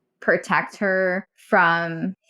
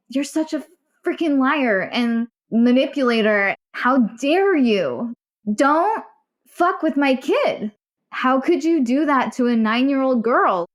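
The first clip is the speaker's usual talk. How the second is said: indignant, higher-pitched telling-off; retold angry scolding; 4 semitones higher than usual. Mother confronting father over their child, retold indignant scolding. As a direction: scold him, leaning on each phrase.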